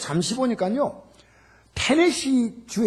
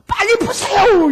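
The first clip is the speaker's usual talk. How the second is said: high loud slow shout; 12 semitones higher, 9 dB louder, slower than usual. Retold or imitated. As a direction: stretch it out, urgent and loud. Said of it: "이렇게 막 소리 질르는 거예요". (천천히 크게 외치는 다급한 목소리)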